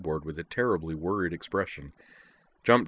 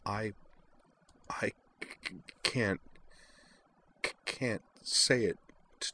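tearful stammer; 8 dB quieter than usual. A narrator's reading. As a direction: force each word through tears. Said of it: "in a small voice".

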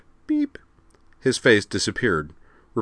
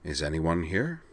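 softly spoken, tentative question; soft call; 7 dB quieter than usual. A narrator's reading. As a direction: ask softly into a darkened room. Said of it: "he said softly".

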